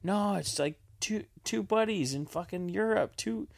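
lightly voiced Ned pleading about buddies in Europe; imitating Ned. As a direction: pitch it up like an excited teenager. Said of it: "Ned was hilarious when he's like".

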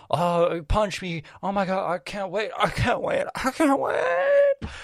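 high, hammed-up villain begging with excitement; mock over-excited villain, escalating; aroused villain. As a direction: build to a drawn-out falsetto squeal on the last words. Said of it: "the villain is like".

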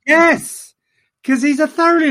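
excited. Real, not acted. Gleeful agreement on favourite Python.

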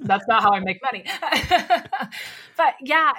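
loud laughter through words; laughing. Real, not acted. Laughing at own bad impression.

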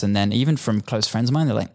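ordinary talk

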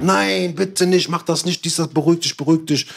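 mock-panicked, high pleading. Others begging after his threat.

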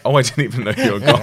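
dry put-down, laughing; sarcastic. Retold or imitated. Sarcastic reply to influencer.

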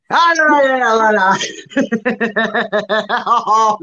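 high, loud cry of protest dissolving into laughter; playful alarm at being exposed; mock-panicked protest. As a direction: wail it in mock horror, then crack up. Mock protest as his secret is revealed.

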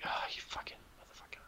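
breathy whispered swearing under the breath; whispered curse. Retold or imitated. Muttering a curse about the shady inventor, whispered. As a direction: whisper the curse so he can't hear.

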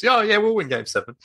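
mock overconfident fan; cocky fan. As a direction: brag like a jinxing fan. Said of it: "the worst thing a Celtic supporter could do".

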